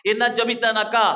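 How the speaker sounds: ordinary talk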